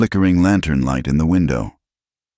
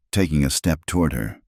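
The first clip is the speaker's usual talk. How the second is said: ordinary delivery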